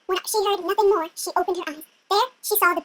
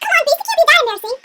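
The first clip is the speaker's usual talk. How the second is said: high, loud pleading princess; hammed-up coaxing; exasperated urging; 8 semitones higher, 5 dB louder than usual. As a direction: plead loudly with mock impatience.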